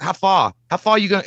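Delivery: put-on playful Boston-like Maine accent. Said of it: "It's like a Boston thing".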